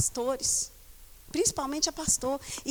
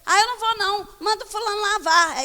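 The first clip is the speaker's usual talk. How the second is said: rebellious son; 6 semitones higher, 4 dB louder than usual. Retold or imitated. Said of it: "você fala pra ele assim".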